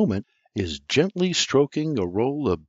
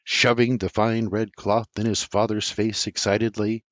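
plain reading voice

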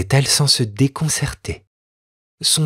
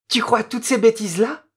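mocking girl; 11 semitones higher, faster than usual. Noémie mocks the boy.

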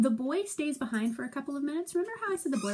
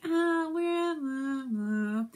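reluctant mock hemming; hesitant soldiers; 3 semitones higher, much slower than usual. Teacher voicing soldiers hanging back, hesitant. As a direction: stall like unwilling soldiers. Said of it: "they're like".

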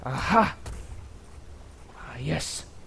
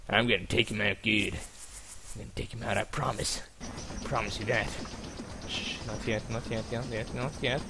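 hushed; 4 semitones lower, 9 dB quieter than usual. Real, not acted. Sneaking up on target.

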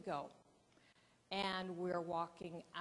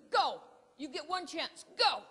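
much louder and higher, barked orders; shouted command; 8 semitones higher, 9 dB louder than usual. Retold or imitated. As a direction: yell go like a fed-up cameraman. Photographer orders her to start.

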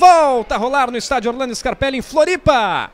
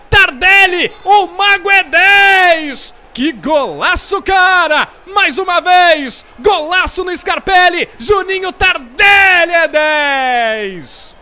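explosive goal commentary. Real, not acted. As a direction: hype the goal at full voice. Praising the scorer, shouting.

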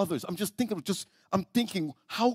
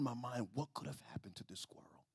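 whisper; 3 semitones lower, 16 dB quieter than usual. Real, not acted. Sermon story, reflective hush.

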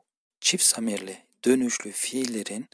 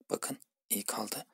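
hushed aside, much softer; soft whisper; 12 dB quieter, slower than usual. Shown as a demonstration. Teacher whispers the ending to students. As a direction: whisper it almost under the breath.

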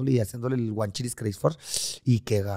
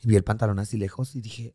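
ordinary delivery

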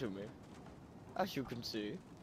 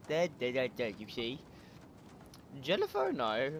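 usual speaking voice

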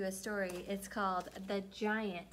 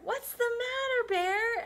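elephant voice; 14 semitones higher, 4 dB louder than usual. Acted. Elephant asks.

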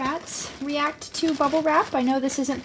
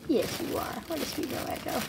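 whispered cooing; 8 dB quieter than usual. Real, not acted. Owner whispering sweet praise to her rat, hushed. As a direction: breathe it to her gently.

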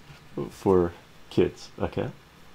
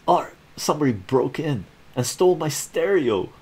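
higher, louder upset complaint; upset Ron; 7 semitones higher, 4 dB louder than usual. Acted. Ron tells Art about the theft, higher and upset. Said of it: "Ron says".

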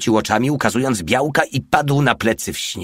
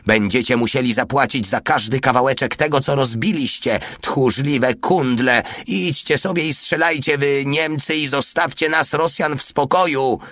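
high-pitched angry tirade; furious shrieking; 6 semitones higher than usual. A narrator's reading. Russian woman railing at soldiers who broke her window, furious. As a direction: scold them in a raised, indignant voice. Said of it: "wrzeszczała wściekle".

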